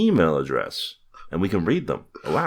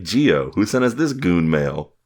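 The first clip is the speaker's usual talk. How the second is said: mock listener question; 2 semitones higher, 3 dB louder, slower than usual. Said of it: "You could be like".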